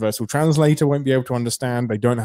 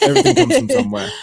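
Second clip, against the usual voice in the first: laughing; 12 semitones higher, 4 dB louder, faster than usual. Real, not acted. Laughing along.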